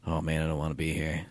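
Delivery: low, soft weary mumble; quiet mumble. Retold or imitated. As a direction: grumble it under your breath. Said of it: "and I go".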